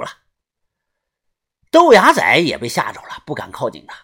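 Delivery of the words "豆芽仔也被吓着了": usual speaking voice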